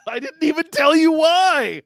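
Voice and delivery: high, loud, laughing outburst; loud protest. Real, not acted. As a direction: protest at the top of your voice. Game master's exasperated interruption of player.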